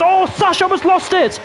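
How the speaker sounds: excited race commentary at peak pitch, gradually settling; shocked play-by-play. Real, not acted. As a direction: burst out high and loud, then keep calling the action. Shocked call of a near crash.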